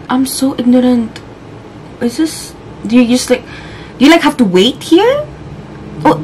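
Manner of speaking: ordinary talk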